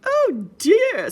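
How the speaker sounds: flustered mother pig; hammed-up oh dear; high fretting mother pig. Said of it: "said Piggy's mother".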